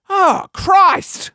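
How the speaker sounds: high, emphatic cry of despair; exasperated. Real, not acted. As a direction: exclaim it, utterly fed up. Despairing at noodle-less pho.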